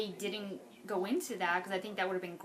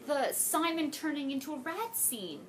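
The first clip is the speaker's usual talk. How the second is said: louder, higher, fed-up demand; exasperated outburst; 4 semitones higher, 5 dB louder than usual. Real, not acted. Fan complaining to viewers about a missing scene, loud and exasperated. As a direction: vent like a betrayed book fan.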